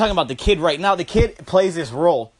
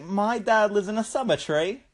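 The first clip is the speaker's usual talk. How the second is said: higher, lightly imitated Aussie boy; creepy Australian kid; 4 semitones higher, 4 dB quieter than usual. Kid tells someone about his dad, creepy Australian kid imitation. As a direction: mimic an eerie child, lifting the last word. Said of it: "The way he said it".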